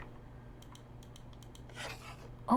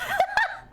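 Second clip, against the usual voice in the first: high giggle. Real, not acted. Laughs at the cute cards.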